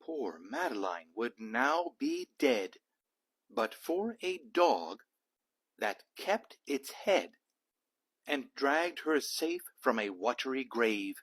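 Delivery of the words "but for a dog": usual speaking voice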